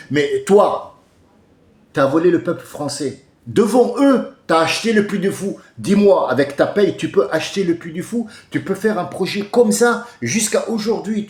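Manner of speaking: loud, high, insistent challenge; heated accusation. Real, not acted. Speaker challenges a politician over buying a park, heated.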